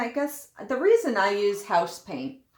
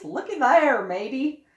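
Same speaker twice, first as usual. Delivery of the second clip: put-on gruff old sailor, louder; 5 semitones higher, 8 dB louder than usual. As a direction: growl it like a pirate. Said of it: "my sailor voice?".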